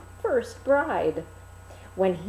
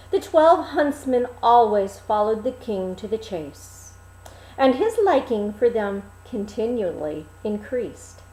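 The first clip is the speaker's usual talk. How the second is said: plain reading voice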